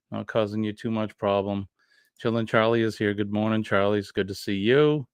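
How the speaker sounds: usual speaking voice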